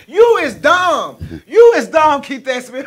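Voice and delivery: loud, high, slow teasing. Real, not acted. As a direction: call it out with delight. Cracking up at a viewer comment.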